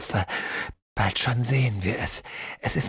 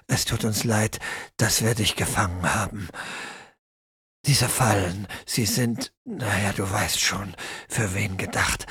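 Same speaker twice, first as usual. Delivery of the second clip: put-on old man Gerd; 5 semitones lower, 3 dB quieter than usual. Old Gerd apologises, asks her leave.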